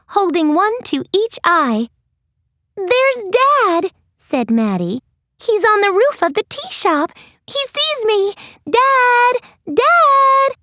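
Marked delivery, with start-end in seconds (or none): 2.8-8.3 put-on much higher, eager child voice; excited girl. Maddie excited seeing Dad. 8.7-10.6 high, loud calling out; shouting to dad. Acted. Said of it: "Maddie shouted, waving".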